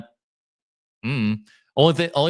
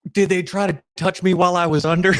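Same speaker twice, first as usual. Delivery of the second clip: higher, put-on innocent question; retold fake worry, pitched up; mock-alarmed patient; 6 semitones higher, 4 dB louder, faster than usual. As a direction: ask it to embarrass your mom. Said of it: "try to embarrass my mom".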